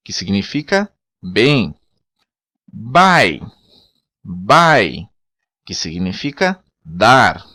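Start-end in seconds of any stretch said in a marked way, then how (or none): 2.7-5.1 bai said "ba-ee"; bai, "ah" then "ee"; Creole word. Shown as a demonstration. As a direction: bai ending on an "ee" sound. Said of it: "o Y tem o mesmo som de I".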